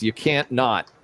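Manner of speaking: usual speaking voice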